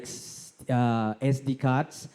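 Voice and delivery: ordinary talk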